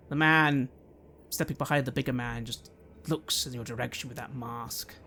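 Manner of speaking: normal talking voice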